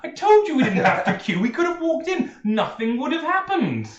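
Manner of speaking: high, loud indignant outburst; outraged Tyndall. Acted. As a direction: squawk it out in disbelief. Tyndall outraged at being robbed.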